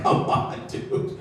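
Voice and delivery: hushed, unvoiced coaxing; whispered aside. Manager whispers to the Dr Pepper guy, hushed. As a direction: lean in and whisper it. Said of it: "he's like".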